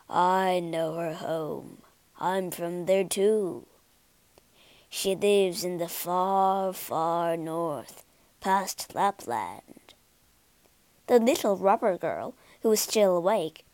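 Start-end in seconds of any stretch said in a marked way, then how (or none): 0.1-9.9 lower, slow reindeer voice; slow deep voice. Acted. Reindeer answers the pigeons, low and slow. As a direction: speak like a big calm reindeer. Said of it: "he said in a slow, deep voice".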